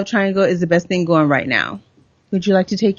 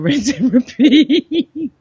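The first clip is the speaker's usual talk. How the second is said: laughing; 5 semitones higher, 4 dB louder, much slower than usual. Real, not acted. Laughing sardonic tag.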